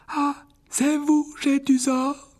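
put-on high, slow frail old wizard; old headmaster voice. A narrator's reading. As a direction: greet him like a frail elderly headmaster. Old headmaster greets the boy. (voix aiguë et lente de vieux directeur)

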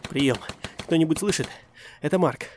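loud high panicked calls; desperate radio call. Acted. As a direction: shout for help over gunfire. Desperate radio call under fire.

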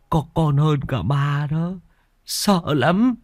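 put-on boy recalling what he saw, slow and afraid; hushed dread. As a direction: say it slowly, still shaken. Boy describes the ghost.

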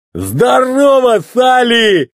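high, slow, drawn-out shout; hollered greeting. A narrator's reading. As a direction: holler a big hello in a put-on voice.

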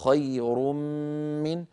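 "خير من" joined by a long humming m, no n left; tanween into meem. Shown as a demonstration. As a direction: melt the end of "خير" into a held m of "من". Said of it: "ذكرنا المثال الثالث وهو".

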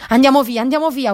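desperate cry to run away; panicked yell. Acted. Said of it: "urlò Mac".

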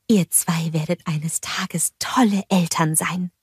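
slow, tender whisper; hushed compliment beside sleeping children. A narrator's reading. Bulda flüstert weiter bei schlafenden Kindern. (langsam und leise geflüstert)

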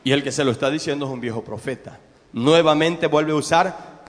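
ordinary talk

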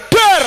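loud, high vocal blast; imitated bang. Storyteller imitating the blow in battle, imitated bang. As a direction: burst out a sudden battle bang.

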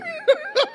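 a high burst of real laughter; hearty laugh. Real, not acted. Laughs at his own story.

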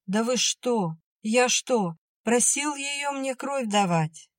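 high, bewildered protest; indignant exclamation. A narrator's reading. Soldier defending himself to Vera, exclaiming. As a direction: plead innocence, pitch climbing and slowing. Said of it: "воскликнул он".

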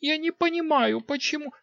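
put-on very high girlish protest; young queen talking back. A narrator's reading.

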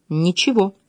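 cool, curt denial; cold reply. A narrator's reading. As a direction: answer with a touch of coldness. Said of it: "холодно ответила она".

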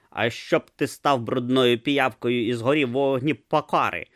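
ordinary delivery, nothing marked

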